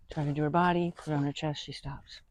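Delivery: ordinary delivery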